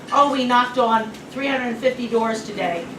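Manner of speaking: ordinary talk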